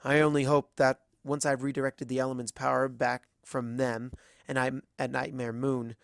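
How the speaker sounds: plain reading voice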